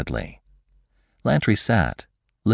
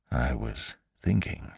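low, very soft, deliberate voice; guarded Lantry; 5 semitones lower, 8 dB quieter than usual. Acted. Lantry answers the scientist's question, guarded, low and soft. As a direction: murmur it carefully.

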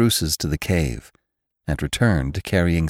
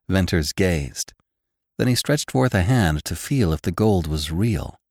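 plain reading voice

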